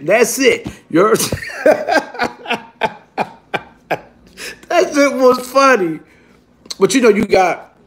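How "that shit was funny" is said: high, slow, through laughter; laughing. Real, not acted. Storyteller amused by his own jail story, laughing. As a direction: say it while cracking up.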